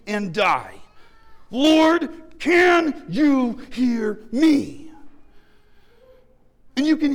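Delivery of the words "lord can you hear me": slow anguished shout; angry prayer. Pretend. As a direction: cry out to God, drawn out. Desperate cry to God.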